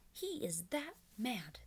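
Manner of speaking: usual speaking voice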